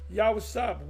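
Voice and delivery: normal talking voice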